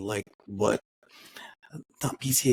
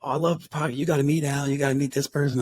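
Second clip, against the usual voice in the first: imitating a gushing fan, higher pitched; super excited; 4 semitones higher, 3 dB louder, faster than usual. Fan urging the podcaster to meet people, super excited. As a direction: gush eagerly like an excited fan. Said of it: "he was just super excited".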